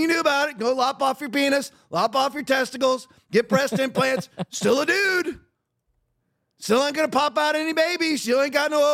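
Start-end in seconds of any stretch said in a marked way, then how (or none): none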